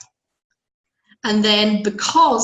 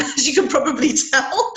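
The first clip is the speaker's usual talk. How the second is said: laughing; 6 semitones higher, 3 dB louder than usual. Real, not acted. Laughs about her croaky voice.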